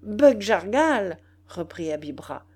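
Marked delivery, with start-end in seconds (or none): 0.0-1.2 loud, slow, drawn-out name; emphatic, stretched retort; defiant challenge. A narrator's reading. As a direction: throw the rival's name at him. Dwarf defies rival chief.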